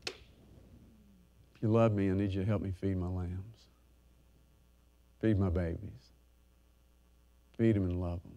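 soft, slow, tender plea; tender appeal. Acted. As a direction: gently ask them to love the babies.

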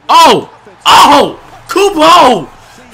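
high, loud excited shouts; real hype shout. Real, not acted. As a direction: yell out the hype. Hyped over a great play.

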